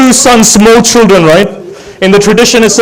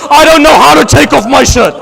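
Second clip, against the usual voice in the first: high, keening elegy voice that keeps climbing; mourning recital lifted to a wailing pitch; rising lament; 5 semitones higher than usual. Real, not acted. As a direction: chant the grief higher and higher. Rising majlis lament chant.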